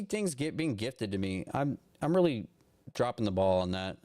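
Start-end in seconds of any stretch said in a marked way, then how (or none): none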